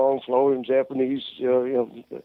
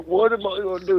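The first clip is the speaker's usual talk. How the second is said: higher, frantic retold plea; panicked; 6 semitones higher than usual. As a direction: imitate a friend in a panic. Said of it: "He was just so panicked".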